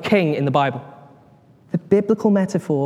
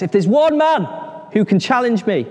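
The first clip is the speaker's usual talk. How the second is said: raised, louder giant's challenge; Goliath's challenge; 5 semitones higher, 3 dB louder than usual. Acted. Goliath challenging the Israelites, loud defiant call. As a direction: call out a dare to a whole army.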